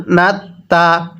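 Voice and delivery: ordinary delivery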